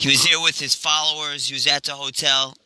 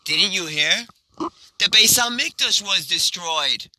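higher, lilting good-news delivery; joyful announcement; 5 semitones higher than usual. Acted. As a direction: share it as happy news. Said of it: "caused them to laugh as well".

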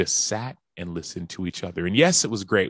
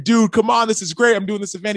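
high, loud "Dude, come on!"; thrilled; 13 semitones higher, 6 dB louder than usual. Retold or imitated. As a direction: burst out with delight at the news. Thrilled at the trade news.

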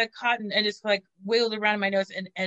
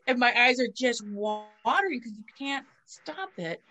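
usual speaking voice

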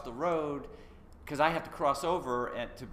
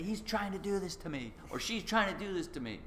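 mock paranoid complaint; 7 semitones higher than usual. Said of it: "I personify the wind and say".

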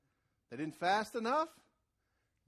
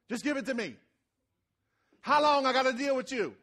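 exasperated outburst; 7 semitones higher, 9 dB louder than usual. Retold or imitated. Fed-up person taking over task.

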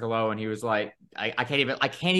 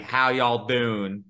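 slow imitation of a Southern accent; much slower than usual. Mimicking a passing stranger's hello in a slow Southern accent. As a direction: mimic a Southern guy's greeting, slow and drawn out. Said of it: "but it was Southern deep".

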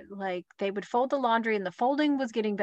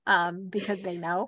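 ordinary delivery

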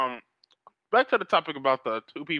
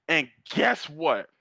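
normal talking voice

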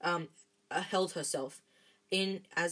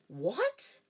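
higher, sharply rising disbelief; astonished question; 6 semitones higher than usual. A narrator's reading. Harry to Dumbledore on startling news, astonished. Incredulous reaction to startling revelation.

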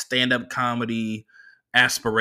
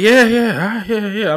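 mock agreeing; 10 semitones higher, 8 dB louder than usual. Fake agreeing to driver.

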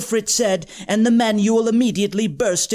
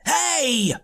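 high-pitched yell without much volume; 6 semitones higher than usual. A narrator's reading. Joshua objects as his friends are chosen, mild yell. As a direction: protest with a raised pitch. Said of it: "Joshua yelled".

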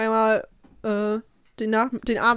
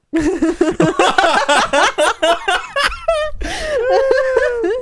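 high crying laugh; laughing to tears; 12 semitones higher, 6 dB louder than usual. Real, not acted. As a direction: laugh till you cry. Laughing fit while crying.

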